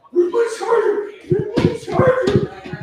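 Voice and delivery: high, loud hammed-up frantic cry; mock panic. Comic imitating someone whose phone battery died, mock panic.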